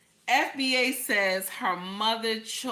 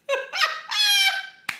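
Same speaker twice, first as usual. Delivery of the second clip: high shrieking laugh. Real, not acted. Laughing at own bit.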